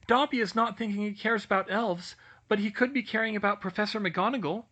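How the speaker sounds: high, eager little elf; house-elf voice. Acted. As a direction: squeak it out like a nervous elf. Dobby explains.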